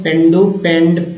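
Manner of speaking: Pendu then pend, drawn out; slow repeat. Shown as a demonstration. Teacher slowly revising the word pair for students. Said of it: "repeating contrast slowly".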